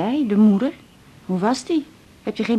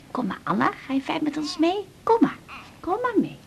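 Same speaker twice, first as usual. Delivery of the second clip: put-on high soft coaxing to the baby; baby talk; 7 semitones higher, 4 dB quieter than usual. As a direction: coax her along sweetly. Cooing baby away. (hoog en zacht lokken, Anna meenemen)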